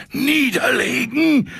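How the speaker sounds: very high, slow, quivering echo; breathless high "Niederlegen?"; trembling excited squeak. Acted. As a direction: shake with excitement on the word. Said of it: "zuckte und schlotterte am ganzen Leib".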